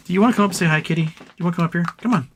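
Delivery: high sing-song call to the cat; coaxing pet voice. Real, not acted. Streamer coaxing his cat onto the desk, high sing-song. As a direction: coax the kitty up sweetly.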